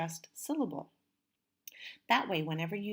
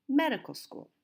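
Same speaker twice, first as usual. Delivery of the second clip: MED in medical school longer and louder; first-syllable stress; 6 semitones higher, 5 dB louder than usual. Shown as a demonstration. Teacher shows the correct way, medical school stressed on MED. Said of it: "The stress is on the first syllable".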